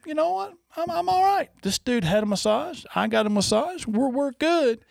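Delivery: high-pitched inner monologue, acted out; self-reassuring. Retold thought, reassuring himself.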